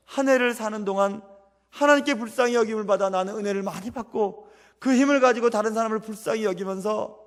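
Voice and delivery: usual speaking voice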